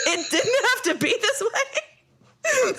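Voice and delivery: laughing it out, high and slow; can't stop laughing while saying it; real laughter through the words. Real, not acted. Laughing at her own realization.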